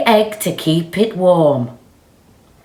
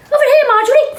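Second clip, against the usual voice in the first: high, loud call out; photographer calling; 6 dB louder, much faster than usual. Acted. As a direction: shout to get her attention.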